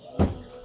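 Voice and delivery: real sigh, struggling for composure; holding back emotion. Real, not acted. After telling the baby's diagnosis, composure-seeking sigh. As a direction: sigh to steady yourself. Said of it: "I'm trying to keep it together".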